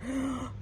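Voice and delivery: sharp, high gasp as if freaking out; acted panicked gasp. Showing someone at the moment of ego death, panicked gasp. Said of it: "you know, freaking out for real".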